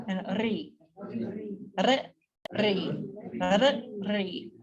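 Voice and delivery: ri with a rolled Sanskrit R; trilled R syllable. Shown as a demonstration. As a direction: roll the R in ri. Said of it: "If you can roll your R's".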